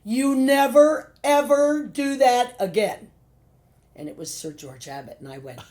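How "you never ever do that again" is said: loud, slow, higher angry scolding; furious director. Retold or imitated. Director scolding actress for stopping the show, loud and slow. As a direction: lay down the law, slow and loud. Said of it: "He said".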